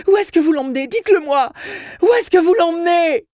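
loud, very high panicked screaming; terrified mother. Acted. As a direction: demand answers in a desperate shriek. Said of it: "Et la voix tremblante de sa mère".

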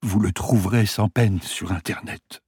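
a hint of a weak old patient; dying man voice.

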